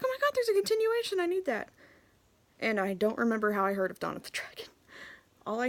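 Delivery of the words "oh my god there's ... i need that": much higher, fast eager rush; excited gush. Retold or imitated. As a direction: gush about a sequel you must have. Sees sequel advertised.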